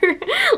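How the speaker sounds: a short high giggle. Real, not acted. Calling the characters her babies, a high giggle. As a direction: giggle with fond delight.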